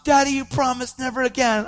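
high-pitched imitation of an upset child; pleading daughter. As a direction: plead like a let-down little girl. Voices his hurt daughter.

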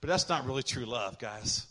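ordinary talk

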